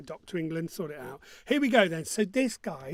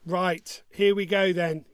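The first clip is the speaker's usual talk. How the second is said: usual speaking voice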